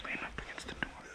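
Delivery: hushed whisper, hiding. Real, not acted. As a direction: whisper so they can't hear.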